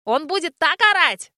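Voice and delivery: put-on boy gleefully predicting dad's yelling. A narrator's reading. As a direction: relish the coming trouble. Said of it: "заранее обрадовался мальчишка".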